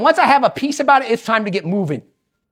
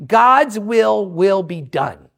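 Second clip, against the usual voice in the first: drawn-out, lower, very slow declaration; slow, weighty motto; 5 semitones lower, much slower than usual. Shown as a demonstration. Preacher stating what he says all the time, slow and weighty. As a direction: stretch each word out solemnly. Motto said slowly, then repeated.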